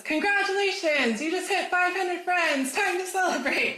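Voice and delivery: high, loud, slow fanfare voice; hammed-up congratulations, stretched out loud; celebratory announcement. Facebook announcing five hundred friends, celebratory.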